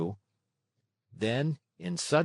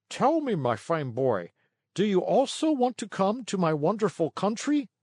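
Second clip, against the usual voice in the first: sweet, coaxing little fat man, higher; wheedling coax; 8 semitones higher than usual. A narrator's reading. Fat driver coaxing Lampwick, wheedling and sweet. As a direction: lure the boy in with a sugary voice. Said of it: "he asked in a wheedling tone".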